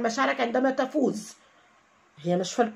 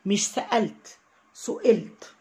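ordinary talk, nothing marked

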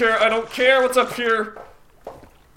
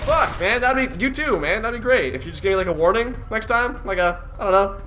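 usual speaking voice

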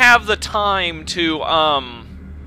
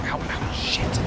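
pained mutter; 5 semitones lower, 10 dB quieter than usual. Real, not acted. Taking damage in game.

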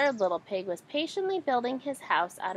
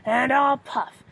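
loud, slow growling wolf; 2 semitones higher, 8 dB louder than usual. A narrator's reading. Wolf threatening the pig's house, loud slow growl. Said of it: "growled the wolf".

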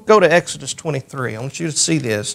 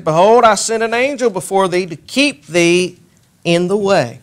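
raised, measured reading of the verse; scripture reading; 5 semitones higher, 3 dB louder than usual. Acted. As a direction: read it out like holy writ. Reads scripture aloud.

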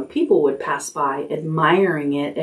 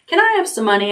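put-on higher eager little girl; child asking; 6 semitones higher, much faster than usual. Fern asking her mother for money, eager child. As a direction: plead like a kid.

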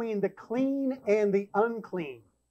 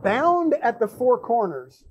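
scripture reading. Acted. Preacher reading Acts to the congregation, solemn and measured. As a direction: read the vision solemnly, with weight. Reads scripture passage aloud.

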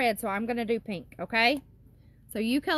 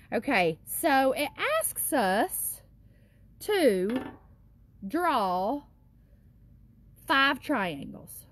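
slow teacher voice; slower than usual. Acted. Teacher reading task to children.

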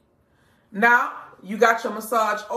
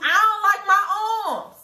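half-laughing, half-crying mock complaint; whiny customer; 8 semitones higher than usual. The shopkeeper mocks a wife who hates her arms, whining through a laugh. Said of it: "For those of you".

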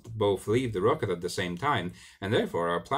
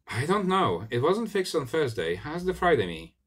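lightly voiced double, pitched up; the Thursday double answering his other self, a touch higher; 7 semitones higher than usual. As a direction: play the Thursday self a little higher and brighter. Thursday me replies.

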